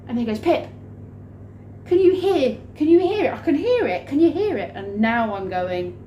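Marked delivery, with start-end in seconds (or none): 0.4-4.7 high, loud, insistent mimicry; hammed-up frantic questioning; frantic boss. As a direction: imitate an over-excited man, pitched up and loud. Rob frantic about hearing the sound.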